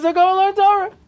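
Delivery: high, loud impatient reply; exasperated prophet. Retold or imitated. Prophet telling Eliezer to just go learn, high and loud. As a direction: toss it back, fed up and loud.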